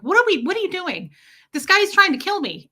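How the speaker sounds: high, hurried exclamation of disbelief; alarmed protest. Retold or imitated. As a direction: protest urgently to an indifferent attendant. Pleads with indifferent attendant in story.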